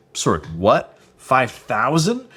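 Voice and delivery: retold disbelief, slow rising question on FIVE THOUSAND; incredulous. Reacting to the guy's test size, incredulous.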